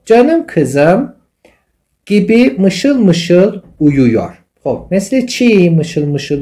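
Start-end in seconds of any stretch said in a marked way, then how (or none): 0.1-4.4 slow reading. Shown as a demonstration. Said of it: "teacher reads example sentence slowly".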